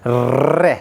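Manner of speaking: slow रे, tongue trilled at the middle of the mouth. Shown as a demonstration. Teacher slowly shows students the rolled रे. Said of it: "अब देखिए".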